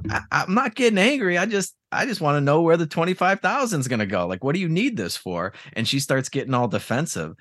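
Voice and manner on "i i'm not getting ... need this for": high, pleading husband voice; a hammed-up husband, pitched up and insisting he's calm; mock calm husband. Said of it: "he's like".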